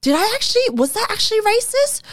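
high, squeaky mock disbelief; incredulous self-question. Replaying her panicked question to herself, high disbelief. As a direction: ask yourself in shocked disbelief, voice jumping way up. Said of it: "I was like".

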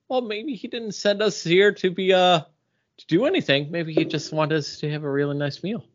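mock his dad musing about the meal; dad quote. Son imitating his dad's musing, a hint of a dad voice. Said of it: "He's like".

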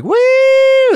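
loud high whooping woo; imitated woo. Comic demonstrating the attention-seeking loud high woo. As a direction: whoop it like a drunk fan. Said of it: "woo is like".

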